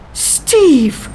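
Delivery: high, loud, startled cry of his name; surprised gasp. A narrator's reading. Pippa spotting the soldier behind her, startled high cry. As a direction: gasp it out, caught off guard. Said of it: "she gasped".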